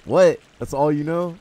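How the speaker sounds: high, loud disbelief; startled, high-pitched questioning; incredulous outburst. Real, not acted. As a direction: blurt it out in disbelief. Startled reaction to the game.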